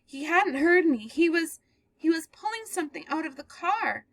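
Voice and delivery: growing dread. Acted. Girl describing the man dragging a body, in dread. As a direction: relive it as you tell it. Girl tells her story.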